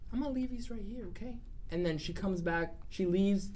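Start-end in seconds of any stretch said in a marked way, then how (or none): 0.1-1.4 higher and softer, a lightly voiced granny; soft old lady. Myrtle leaving cookies for the party, soft granny voice. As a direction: set them down gently, high and soft. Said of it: "this old lady".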